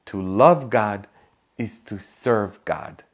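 ordinary talk, nothing marked

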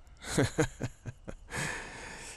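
voiceless run of chuckles; breathy chuckle. Real, not acted. Speaker amused by his own bit, breathy chuckle.

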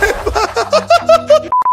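high-pitched giggle; high laugh. Real, not acted. Gamer laughing at his zoo mess, high giggle. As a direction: burst into a squeaky laugh.

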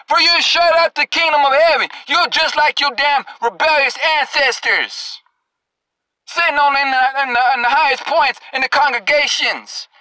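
ordinary talk

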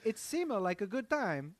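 high, hammed-up Italian accent. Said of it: "I did an Italian accent".

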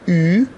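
او as a loud, long German ü; drawn-out, rounded u-umlaut. Shown as a demonstration. Teacher demonstrates u-umlaut alone, او loud, long and rounded. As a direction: round the lips and stretch او into ü.

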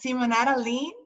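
Simonetta Lin said the Italian way, with flair; own name with flair. Shown as a demonstration. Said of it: "pronounce your name for everybody because you say it with flair".

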